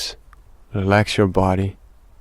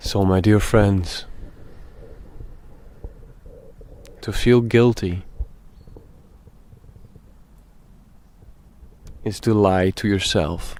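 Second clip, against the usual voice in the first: slow meditation talk with very long pauses; speaking slowly; 5 dB quieter than usual. Real, not acted. Guide tells dear friends about guilt, slow and meditative. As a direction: let each phrase hang in silence before the next. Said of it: "we are speaking rather slowly".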